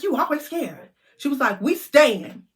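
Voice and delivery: taunt, then insist; bossy sister. Retold or imitated. Sister refusing to leave the party, bossy.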